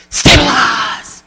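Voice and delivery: sharp gasp, then slow drawn-out stabilize; gasp, then slowly settle yourself; calming mantra. Shown as a demonstration. Speaker demonstrating the meltdown whammy to consultants. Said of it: "You're gonna go".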